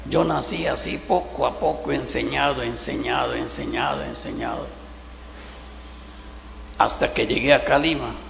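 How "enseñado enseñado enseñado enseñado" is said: fading echo. Real, not acted. Repetition dying away.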